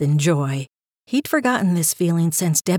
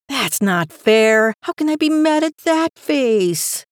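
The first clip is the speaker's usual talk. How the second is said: mock complaint, drawn out then higher; helpless protest; 5 semitones higher than usual. A narrator's reading. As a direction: protest as you give in to the puppy. Owner melting at puppy's sad look.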